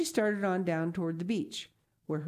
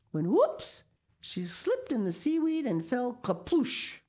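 usual speaking voice

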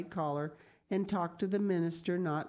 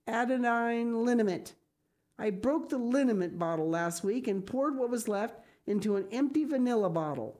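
put-on high, louder scolding older woman; exasperated Marilla; 6 semitones higher, 3 dB louder than usual. A narrator's reading. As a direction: scold and half blame herself.

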